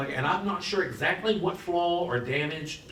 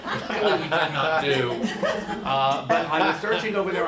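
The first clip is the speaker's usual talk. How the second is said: laughing; 3 semitones higher, 5 dB louder than usual. Real, not acted. Laughs at own plan.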